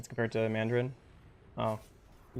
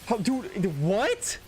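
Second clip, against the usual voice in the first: surprised; 8 semitones higher, much slower than usual. Real, not acted. Stunned by a game play.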